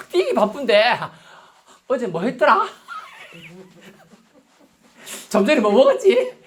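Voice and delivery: high-pitched, hammed-up musing mid-run; mock running chatter. Preacher playing a runner thinking of yesterday, mock distracted. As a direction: wonder aloud while pretending to race.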